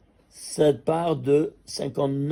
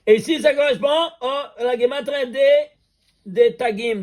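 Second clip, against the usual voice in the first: higher and louder, laughing with excitement; excited discovery; 8 semitones higher, 5 dB louder than usual. Real, not acted. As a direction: share the find with rising glee. Excited reveal of gematria discovery.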